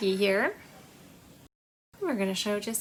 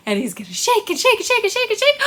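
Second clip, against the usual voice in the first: much higher, louder and fast, rising thrill; excited build; 11 semitones higher, 7 dB louder, much faster than usual. Pretend. Teacher shaking the toy tiger for the children, gleeful and fast. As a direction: rattle it off with mounting glee.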